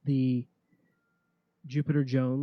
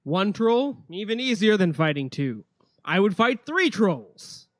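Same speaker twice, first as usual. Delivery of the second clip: boastful warrior; 4 semitones higher, 5 dB louder than usual. Acted. NPC boasting about trolls.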